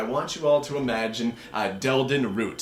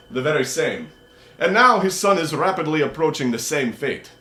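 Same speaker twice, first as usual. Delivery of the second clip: Rakshasa's orders; 5 semitones higher, 6 dB louder than usual. Acted. GM voicing Rakshasa.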